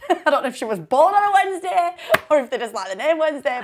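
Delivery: high, laughing explanation. Real, not acted. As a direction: talk through real giggles. Laughing at the baby's name.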